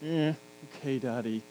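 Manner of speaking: low, soft, grudging okay; reluctant daughter. Retold or imitated. Dad imitates his daughter grudgingly agreeing to clean her room, low and soft. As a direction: drag it out like a sulky child. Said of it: "or I get".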